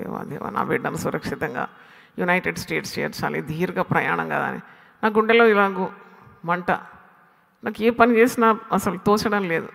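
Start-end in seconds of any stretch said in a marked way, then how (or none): 0.0-4.5 low, soft re-enacted plea to God; murmured prayer. Parent praying to God for a child's long journey, soft murmured prayer. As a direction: recreate your own hushed, private prayer. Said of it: "అని ప్రార్థన చేస్తున్నాను".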